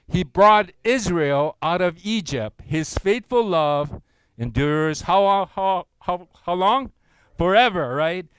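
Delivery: loud, slow, measured reading aloud; congregational scripture reading. Acted. Pastor leading the church in reading Psalm, loud and slow. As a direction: lead the room through the verse.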